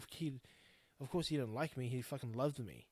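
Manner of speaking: ordinary delivery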